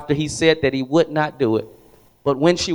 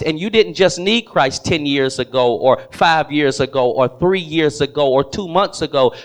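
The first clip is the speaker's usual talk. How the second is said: ordinary talk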